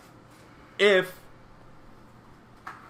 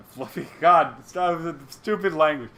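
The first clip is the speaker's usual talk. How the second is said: normal talking voice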